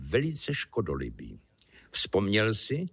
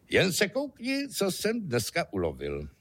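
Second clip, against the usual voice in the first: chlubivé zvolání; 8 semitones higher than usual. Acted. Starosta se chlubí ženě úlovkem.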